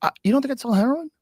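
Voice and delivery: high, fast incredulous question; mock incredulous government. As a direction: play the shady official, amazed. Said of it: "I'm gonna kill".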